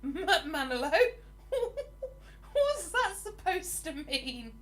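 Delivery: high, laughing bewilderment; baffled laughter. Real, not acted. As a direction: crack up laughing, question the viewer in disbelief. Baffled laughter at viewer's comment.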